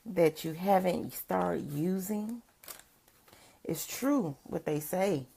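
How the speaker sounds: usual speaking voice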